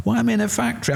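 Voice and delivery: high, bewildered asking why he is in a factory; puzzled, pitch jumping up; confused question. Retold or imitated. Confused patient asking wife.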